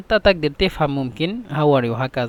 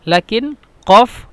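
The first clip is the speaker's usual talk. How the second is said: قاف said slowly and louder; stress on qaf; 4 semitones higher, 6 dB louder than usual. Shown as a demonstration. Teacher stressing the letter قاف to learners. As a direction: lean hard and slow on قاف. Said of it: "يعني للقاف".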